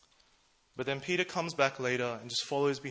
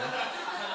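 laugh. Real, not acted. Self-deprecating joke about treasurer.

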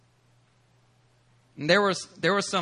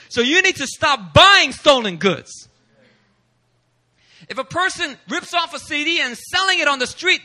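preaching at full force; 7 semitones higher, 5 dB louder than usual. Acted. A preacher warning the congregation about stolen goods, full force. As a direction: preach it with rising fire.

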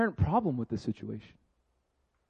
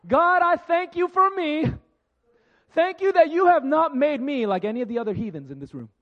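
pompous Pharisee prayer; 11 semitones higher, 7 dB louder than usual. Retold or imitated. A preacher mocking a smug Pharisee thanking God for himself, high and loud. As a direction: lord it over the room like a smug Pharisee praying.

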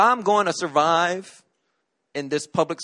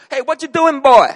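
higher, louder voice of a concerned teacher; Trinidadian teacher; 8 semitones higher, 10 dB louder than usual. Retold or imitated. Teacher calling to a crying boy, raised and higher. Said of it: "Missus Pekin saw me, and she said".

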